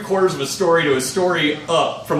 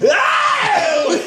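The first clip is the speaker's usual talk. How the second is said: a sudden yelp, much higher; imitated pained yelp. Bloodied guy hit by a beer can, an imitated high yelp. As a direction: squeal like the can just hit your face.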